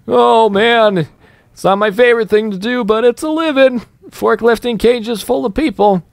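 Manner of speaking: a chirpy worker shrugging off his grim job; forklift worker voice. Pretend. Playful gamer voices the worker moving cages of people.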